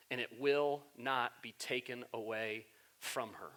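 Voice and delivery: quiet calming answer to a frantic host; calm gentle Jesus. Retold or imitated. Jesus calmly answering Martha.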